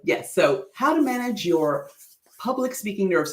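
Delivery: normal talking voice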